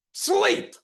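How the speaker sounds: sudden very high, loud scream of one word; screamed command. Retold or imitated. Hypnotist screaming the command at his client. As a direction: scream the word to drop him into trance. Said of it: "I screamed".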